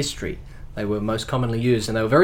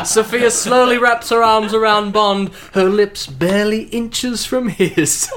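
story narration; 7 semitones higher, 4 dB louder, slower than usual. Acted. Reads radio play narration.

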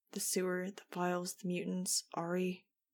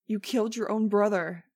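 loud accusing scream; 2 semitones higher, 9 dB louder than usual. A narrator's reading. Jeb screaming at Max as she flees, loud. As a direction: scream the accusation after her. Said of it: "He was screaming at me".

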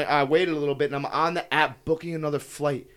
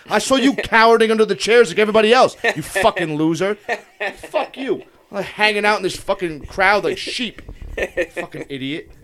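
heated, raised-pitch comic rant; furious; 5 semitones higher, 5 dB louder than usual. Real, not acted. Traveler ranting about airport agents while retelling it, furious. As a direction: tear into them, worked up and indignant. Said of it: "You're furious right now telling the story".

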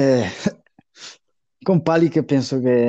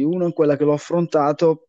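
normal talking voice

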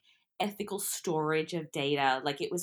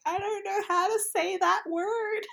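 whiny younger self; 12 semitones higher than usual. Retold or imitated. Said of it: "I'm like".

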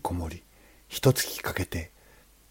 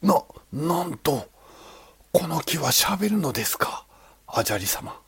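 astonished; 9 semitones higher than usual. Acted. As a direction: stammer out the shock. Sculptor hears the tree speak.

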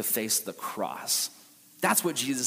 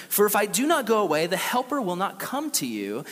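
scripture reading; 3 semitones higher than usual. Acted.

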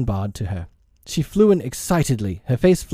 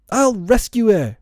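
put-on high, raised boyish cry; heroic cry; 11 semitones higher, 4 dB louder than usual. A narrator's reading. Peter leaping at his weapons, high heroic cry. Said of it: "he cried".

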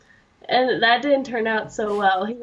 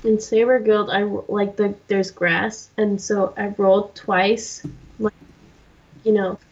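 ordinary talk

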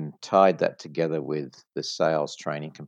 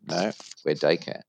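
usual speaking voice

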